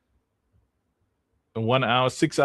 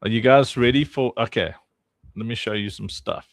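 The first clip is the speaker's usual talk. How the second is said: ordinary delivery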